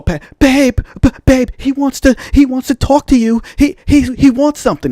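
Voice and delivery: high-pitched, eager imitation of a wife; bubbly wife. Wife stops her husband for a stranger, bubbly and high. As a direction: pipe up excitedly as the outgoing wife. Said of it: "big, bubbly, always, always outgoing person".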